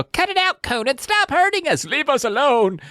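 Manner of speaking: mock child screaming for help; kid yelling. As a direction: shriek like a bullied little kid. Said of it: "a little kid yell".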